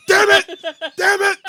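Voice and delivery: high, very loud repeated outburst; imitated yelling. Host recreating what the wrestler screamed out, yelled. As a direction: yell it as a mock wrestler tantrum. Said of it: "He just yelled".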